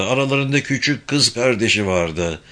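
plain reading voice

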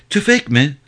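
high, lightly tearful question; tearful, muffled. A narrator's reading. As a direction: ask through tears and a handkerchief. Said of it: "sesi de gözyaşlarından ve mendilden ötürü boğuktu".

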